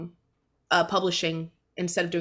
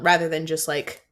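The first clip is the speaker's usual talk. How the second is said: usual speaking voice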